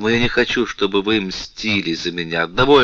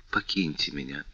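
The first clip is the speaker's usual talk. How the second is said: much softer, lower; soft plea; 6 semitones lower, 10 dB quieter than usual. A narrator's reading. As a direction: barely breathe the request. Louise begs him to leave.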